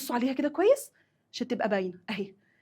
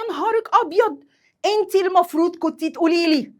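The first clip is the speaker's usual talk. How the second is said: acted scolding, pitched high and louder; reproachful; 6 semitones higher, 5 dB louder than usual. Woman blaming her sister over the borrowed mobile, reproachful. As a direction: blame her in a raised, high voice. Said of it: "يبقى أنا بلومها".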